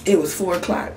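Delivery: ordinary talk, nothing marked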